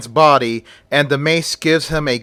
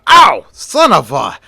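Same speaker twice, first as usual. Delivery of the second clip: pained yelp; 6 dB louder than usual. Acted. Officer shocked by the mask, yelping. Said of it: "The officer screams".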